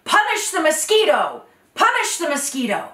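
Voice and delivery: rally the animals; crowd shout. Acted. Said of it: "all the animals shouted".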